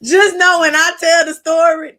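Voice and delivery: high and loud, slow and teasing; playful shimmy. Acted. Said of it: "She put a shimmy into it".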